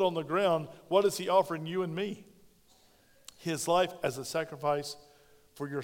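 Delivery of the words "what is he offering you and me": normal talking voice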